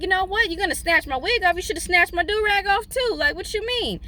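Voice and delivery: high, indignant rant; sassy retold fury at the water; mock outrage. Woman scolding the river current, high and indignant.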